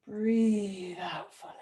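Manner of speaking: slow, whispered exhale on the words; breathed-out whisper. Real, not acted. Yoga teacher guiding students to breathe out fully, in a slow whisper. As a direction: let the words ride out on a long breath.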